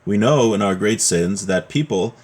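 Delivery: ordinary delivery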